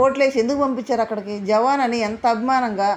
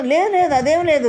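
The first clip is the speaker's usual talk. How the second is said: higher, louder flustered no, no; quick flustered denial; 5 semitones higher, 4 dB louder than usual. Retold or imitated. Divya hastily denying she played in a group. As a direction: brush the question off in a rush. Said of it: "అడగనంటే".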